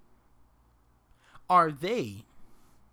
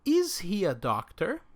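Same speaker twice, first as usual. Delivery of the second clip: "Is he a doctor?" lifted at the end for students to copy; rising question tune. Shown as a demonstration. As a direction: model the question, rise at the end. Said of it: "So repeat after me".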